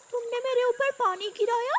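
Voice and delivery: put-on high, indignant boy-bird voice; outraged. Brother just drenched by his sister, outraged. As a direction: demand it in shocked anger.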